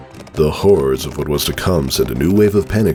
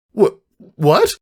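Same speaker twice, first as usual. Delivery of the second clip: higher, baffled stammer; stunned stammer; 14 semitones higher than usual. Acted. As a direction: trip over the word in shock. Caramel stunned.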